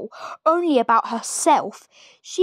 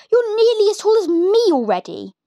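put-on higher, mean old woman's voice; nasty Grandma; 9 semitones higher, 4 dB louder than usual. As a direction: sneer it like a wicked granny. Grandma scolds.

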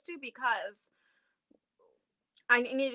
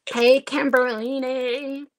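1 drawn-out greeting; 11 dB louder, much slower than usual. Real, not acted. Greeting a viewer by nickname.

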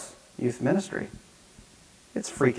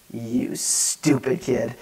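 very slow, drawn-out contempt, softer; seething disgust; 5 dB quieter, much slower than usual. Retold or imitated. Youth worker silently cursing the teen, seething. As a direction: stretch it out with held-in exasperation. Exasperated inner thought about teen.

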